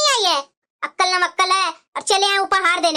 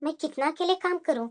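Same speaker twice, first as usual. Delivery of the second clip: muttered aside; 8 semitones lower, 8 dB quieter than usual. Acted. Elder bahu grumbling aside.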